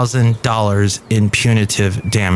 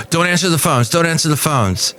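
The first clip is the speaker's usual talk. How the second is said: gasping, then a higher, urgent plea; mock panic; 5 semitones higher than usual. DJ freaking out at the next shift's host, mildly panicked. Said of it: "he's freaking out".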